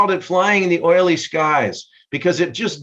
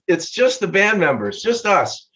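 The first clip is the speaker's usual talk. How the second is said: usual speaking voice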